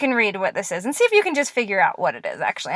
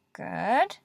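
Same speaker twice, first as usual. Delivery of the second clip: usual speaking voice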